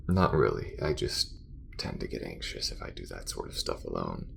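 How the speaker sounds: awkward, halting admission of nerves; embarrassed thanks turning to mock threat; guarded space crewmate. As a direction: own up reluctantly, with long hesitations. Acted character admits space anxiety.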